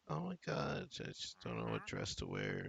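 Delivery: put-on airy, ditzy valley girl. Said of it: "she's like a valley girl".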